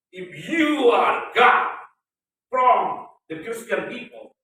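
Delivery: loud, slow, drawn-out challenge shouted upward; defiant shout at God. Retold or imitated. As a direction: hurl the dare at heaven, slowly and loud. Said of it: "I will challenge God like that".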